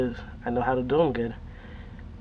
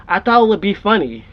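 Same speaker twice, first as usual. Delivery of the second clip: higher, louder mock kid answer; imitated kid's excuse; 5 semitones higher, 7 dB louder than usual. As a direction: blurt it out as the punchline. Boy's excuse acted as punchline.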